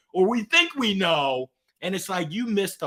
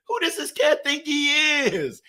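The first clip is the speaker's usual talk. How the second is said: imitating older kids; 8 semitones higher than usual. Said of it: "It's like".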